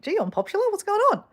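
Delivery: startled and puzzled at a buzzing phone; surprised. Retold or imitated. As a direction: squeak it out, caught off guard. Phone suddenly full of messages.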